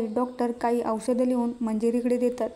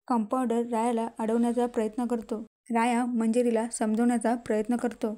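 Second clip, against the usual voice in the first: plain reading voice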